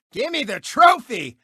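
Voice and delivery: ordinary delivery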